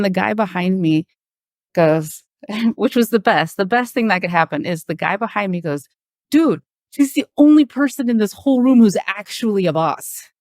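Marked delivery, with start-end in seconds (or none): 6.3-10.3 coworker's defence. Retold or imitated. Coworker sticks up for her.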